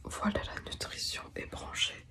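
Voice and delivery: quiet night whisper. Real, not acted. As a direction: keep it to a hush. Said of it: "donc je chuchote".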